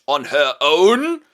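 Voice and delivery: slow, loud disbelieving drawl; pointed, slow doubt; suspicious. Acted. Father suspicious of daughter alone.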